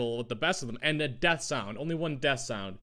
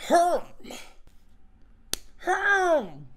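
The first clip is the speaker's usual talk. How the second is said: villager grunt; 9 semitones higher, much slower than usual. Acted. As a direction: bark it out like a villager. That's, that's a keeper.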